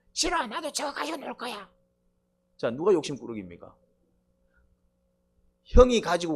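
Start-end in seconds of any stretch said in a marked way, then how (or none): 0.1-1.7 put-on much higher, petulant child refusing; little brother refusing his mom, higher petulant whine; stubborn kid. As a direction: pout and insist like a small child. Stubborn child voiced in example.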